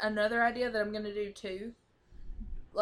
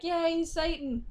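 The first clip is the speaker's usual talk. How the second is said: mock cheer; 8 semitones higher, slower than usual. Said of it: "I'd be like".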